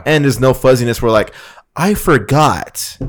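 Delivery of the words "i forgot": slow, drawn-out mimicry of an excuse-maker; imitating someone playing dumb; mock forgetfulness. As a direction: stretch it out, higher, like a phony excuse. Mimics client feigning forgetfulness.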